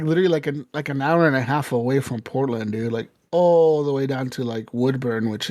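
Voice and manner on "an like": ordinary talk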